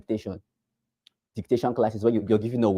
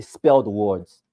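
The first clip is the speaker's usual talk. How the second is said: teacher hammering Spell; stress on spell; 3 semitones lower, 3 dB louder, much slower than usual. Shown as a demonstration. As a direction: Spell drawn out slow and heavy, falling. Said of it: "my emphasis is on the word, is on the word spell".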